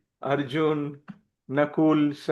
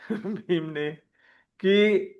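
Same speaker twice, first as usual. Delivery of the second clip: ordinary talk, nothing marked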